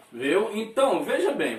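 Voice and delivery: ordinary delivery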